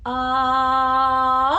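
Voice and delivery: long loud ah, rising at the end; high sustained ah. Shown as a demonstration. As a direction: slide the ah up as high as it goes. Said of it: "go up as high as we can".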